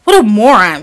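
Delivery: loud scornful outburst; angry cry. A narrator's reading. Fritz scorns the broken nutcracker with a loud cry. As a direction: bark it at the toy. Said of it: "cried Fritz".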